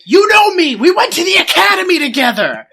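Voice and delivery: high, loud mock desperation; over-the-top movie-cop yelling; hammed-up pleading shout. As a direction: plead at the top of your voice to be recognised. Acting out pleading when in trouble.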